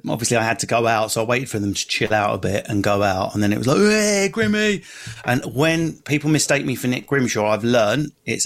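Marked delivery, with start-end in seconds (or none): 3.8-4.8 calling out. Retold or imitated. Fans calling out to him.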